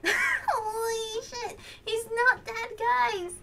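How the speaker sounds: high, loud, drawn-out wail; real tearful shock. Real, not acted. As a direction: cry out in overwhelmed disbelief. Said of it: "I'm trying not to cry".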